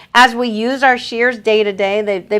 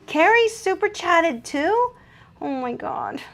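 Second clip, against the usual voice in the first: surprised; 9 semitones higher than usual. Real, not acted. Second super chat arrives.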